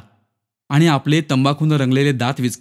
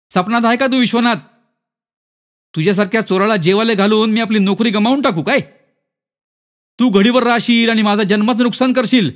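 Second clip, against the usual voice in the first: indignant, high-pitched telling-off; raised-pitch scolding character voice; scornful refusal; 8 semitones higher, 3 dB louder than usual. Acted. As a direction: rebuff a beggar with mounting scorn.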